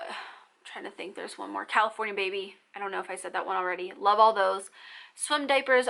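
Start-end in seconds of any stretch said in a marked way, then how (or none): 0.1-1.6 very soft mutter to yourself; thinking aloud. Real, not acted. Mom trying to recall one more sunscreen brand, muttered thinking aloud. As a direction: murmur it under your breath while recalling.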